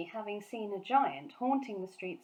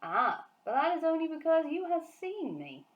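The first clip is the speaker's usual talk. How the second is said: higher, eager giant cry; the giant exclaims, lightly raised; 9 semitones higher, 4 dB louder than usual. Acted. Giant exclaiming to Sophie, mild cry. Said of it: "cried the B F G".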